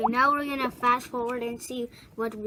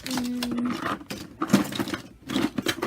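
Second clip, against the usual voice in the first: normal talking voice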